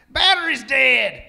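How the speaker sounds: slow, loud dim reply. Retold or imitated. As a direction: state it dumbly. Melvin answers.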